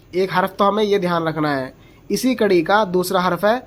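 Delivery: usual speaking voice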